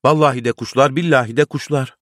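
high-pitched, eager oath; excited insistence. A narrator's reading. Tahir swearing to the crowd it is birds, excited insistence.

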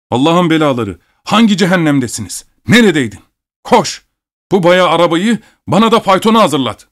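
high, urgent angry orders; exasperated master barking commands; furious scolding. A narrator's reading. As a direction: lay into the servant, voice climbing. Furious master berates servant, gives orders.

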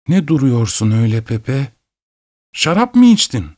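mother's voice rising sharply high; half scolding, half worried, going up high; suspicious mother. Acted. Mother to son standing silent, sharply rising voice.

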